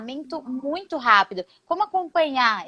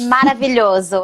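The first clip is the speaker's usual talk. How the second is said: a loud, delighted burst of praise; excited exclamation; 8 dB louder than usual. Real, not acted. Host cheering her guest's knee joke, loud and delighted. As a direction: cheer the joke like you loved it.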